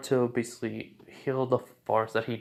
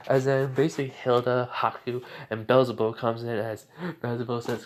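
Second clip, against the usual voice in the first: ordinary delivery, nothing marked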